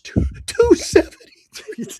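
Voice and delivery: high, drawn out through helpless laughter; laughing. Real, not acted. Reading a dismal win percentage to co-host, laughing. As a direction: laugh through the figure in disbelief. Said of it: "All you could do is laugh".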